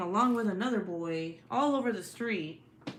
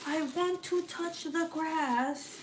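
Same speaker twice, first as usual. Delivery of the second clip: put-on much higher, slow pleading kid voice; mock whiny child; imitating whiny son; 8 semitones higher, slower than usual. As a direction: whine it like a bored kid stuck inside. Mimicking her grounded son again.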